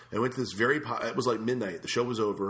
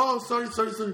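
flustered waiter; 12 semitones higher, 3 dB louder than usual. Retold or imitated. Waiter apologizing.

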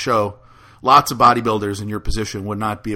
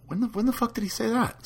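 high-pitched, incredulous question; baffled; 13 semitones higher, 8 dB quieter than usual. Retold or imitated. Host recalls his reply to quoted emails, baffled. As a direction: retell it as a baffled, high-voiced query.

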